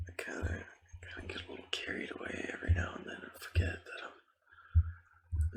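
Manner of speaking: hushed, mostly voiceless game commentary; ASMR whisper. Real, not acted. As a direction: whisper casual gameplay chatter to the listener.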